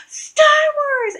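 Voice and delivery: high, loud fangirl holler; excited calling out. Retold or imitated. Girls calling to her outside Subway, excited yell. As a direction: mimic a child yelling to catch someone's attention.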